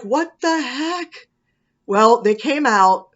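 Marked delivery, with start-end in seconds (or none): 0.0-1.3 high, slow disbelieving "what the heck"; incredulous. Retold or imitated. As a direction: stretch it out in shocked disbelief. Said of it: "And I'm like".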